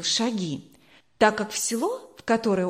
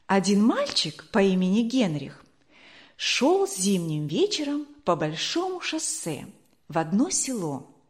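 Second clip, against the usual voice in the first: ordinary delivery, nothing marked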